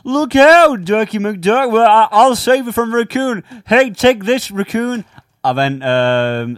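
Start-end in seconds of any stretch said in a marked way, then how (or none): 0.0-5.0 high, loud heroic cartoon cry; higher, louder hammed-up rescue. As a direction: charge in to save your buddy, cartoon style. Cartoon skit, hero to rescue.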